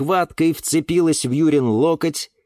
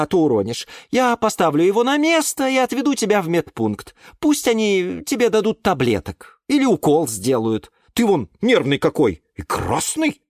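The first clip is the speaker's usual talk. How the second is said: higher, lightly voiced scolding caretaker; scolding aunt Glasha; 7 semitones higher than usual. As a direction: nag him like a fed-up caretaker. (тётя Глаша ворчит на Юру, слегка сыгранно)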